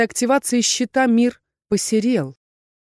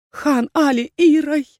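ordinary delivery

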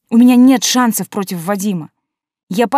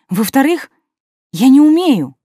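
about to burst into tears; on the verge of tears; 6 semitones higher, 6 dB louder than usual. Acted. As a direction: voice breaking, higher and louder. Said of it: "Неожиданно всхлипнув".

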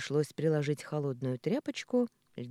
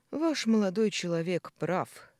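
slow, louder, weighty verdict; profound pronouncement; 5 semitones higher, 5 dB louder than usual. A narrator's reading. Beaten neighbour siding with Николя, sententious. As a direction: pronounce it with mock depth. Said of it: "глубокомысленно изрёк Михаил".

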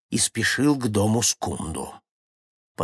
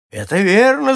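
high, loud acted shout; crowd shouting agreement; 10 semitones higher, 8 dB louder than usual. A narrator's reading. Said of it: "закричали все сразу". (громкий крик согласия толпы)

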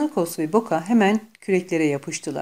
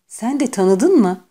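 slow, louder disbelieving repeat; stunned, pressing the question; disbelieving whisper; 4 semitones higher, 7 dB louder, slower than usual. A narrator's reading. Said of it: "Fısıldayarak".

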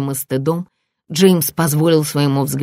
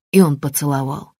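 ordinary delivery

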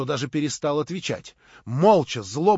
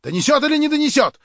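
loud, high, mounting agitation; hail of questions; 9 semitones higher, 9 dB louder than usual. A narrator's reading. Agitated crowd demands who Shatov is, shouting questions. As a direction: pelt the questions out, rising to shouts. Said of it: "сыпались вопросы".

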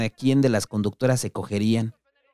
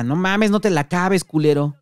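much higher, loud protesting yell; shouting complaint; 7 semitones higher, 4 dB louder than usual. Acted. Dani yelling at the patas hogging the joint. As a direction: cry out before it's gone. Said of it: "Dani grita".

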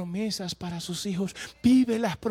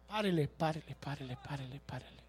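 fading repetition; 7 semitones lower, 9 dB quieter than usual. Real, not acted. Fading repeated stop call.

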